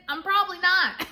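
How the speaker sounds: choking up as she admits the truth; voice cracking. Real, not acted. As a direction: let the crying catch the words. Admitting she's not good.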